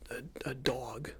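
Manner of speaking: usual speaking voice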